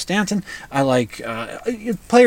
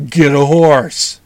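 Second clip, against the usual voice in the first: slow, louder mocking taunt; taunting jeer; 2 semitones lower, 6 dB louder, much slower than usual. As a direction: drag it out like a smug heckler. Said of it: "they would laugh ... and say".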